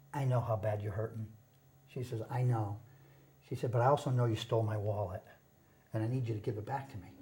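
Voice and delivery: softly voicing mom's hurt, low and hushed; a hint of a tearful mother, kept quiet; mother's quiet plea. Son retells mother's plea from car, hushed. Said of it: "She started crying".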